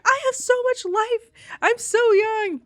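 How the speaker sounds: high-pitched, indignant mock wail; retold angry protest. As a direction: imitate a girl furious at dying young. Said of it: "And she's mad".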